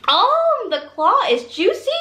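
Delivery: high, delighted "Oh"; thrilled by the juicy claw; excited. Real, not acted.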